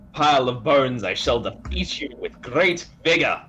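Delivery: loud, declamatory challenge to a foe; heroic battle challenge. Acted. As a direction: declaim it like a boastful hero. Player's battle cry in character.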